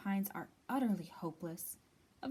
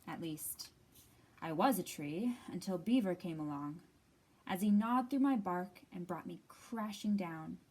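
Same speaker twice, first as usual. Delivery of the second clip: usual speaking voice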